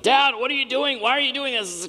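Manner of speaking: put-on high-pitched child's complaint; protesting kid. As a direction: mimic a kid squirming out of a hug. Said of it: "And they're like".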